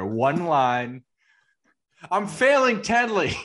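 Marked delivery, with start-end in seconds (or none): 2.1-3.3 sudden hammed-up cry, much higher and louder; mock outburst. Friend mimicking the dad's party outburst, mock wail. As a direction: blurt it out of nowhere like a comic confession. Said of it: "Just an outburst out of nowhere".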